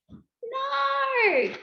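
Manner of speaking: sudden high shout of no; mock shout. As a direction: cry out in mock horror. Teacher reacting to cat eating music.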